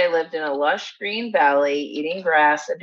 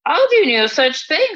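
much higher and louder refusal; indignant; 10 semitones higher, 5 dB louder than usual. Acted. Goat refuses troll indignantly.

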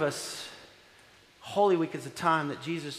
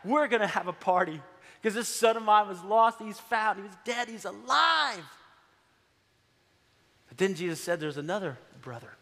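ordinary talk, nothing marked

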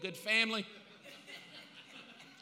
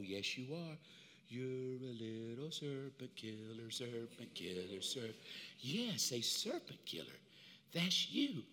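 put-on softly coos to her baby, lower and softer; a mother crooning over a cradle; cooing mother; 7 semitones lower, 9 dB quieter than usual. Eve cooing softly to her baby son, gently.

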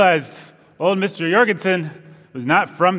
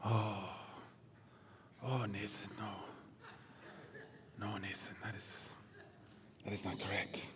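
very hushed, low mock-disappointed teacher; Mister Jorgensen; 8 semitones lower, 26 dB quieter, slower than usual. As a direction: imitate a teacher gently turning down a wrong answer, barely audible, halting. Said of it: "And then he would go".

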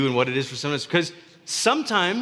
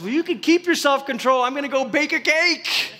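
high, cheeky retort; defiant Frog; 9 semitones higher than usual. Acted. Frog answering Toad in a high, cheeky voice. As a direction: toss it off with cheek.

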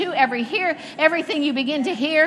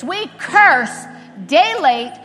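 loud, rising preacher cadence; preaching at full force; 4 dB louder than usual. Acted. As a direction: proclaim it like a revival preacher. Preacher commanding blessings over congregation.

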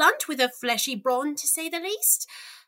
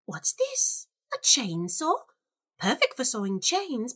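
usual speaking voice

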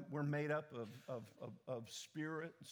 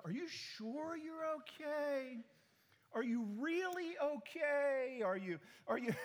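higher and slow, prodding worried questions; mock probing concern; 9 semitones higher, 4 dB louder, slower than usual. People pressing the pastor about his health, slow high mock worry. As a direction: drag it out like a doubtful friend pressing. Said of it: "when people go".

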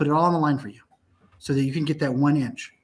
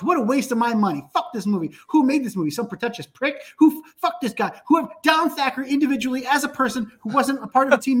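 mock angry reviewer; 6 semitones higher than usual.

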